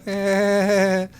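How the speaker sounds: wheezy pained cartoon laugh; Peter Griffin laugh. Real, not acted. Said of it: "the Peter Griffin laugh".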